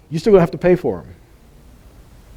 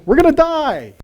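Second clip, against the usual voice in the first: mock panic; 12 semitones higher than usual. Mocking the people's panic.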